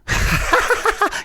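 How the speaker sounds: real high cackle at a slip; high-pitched laugh. Real, not acted. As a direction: burst out laughing at your own mistake. Laughs at own botched joke.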